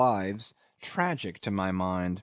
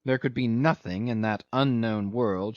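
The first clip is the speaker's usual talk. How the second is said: usual speaking voice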